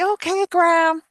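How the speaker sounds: put-on high, loud, slow little boy reply. Grandson answering grandma in a high little boy voice. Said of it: "he'd say".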